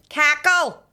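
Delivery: high, loud call with falling end; calling a child. Retold or imitated. Woman in the Kennedy Centre imitated calling her child, loudly. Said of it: "There was a woman in the Kennedy Centre before calling".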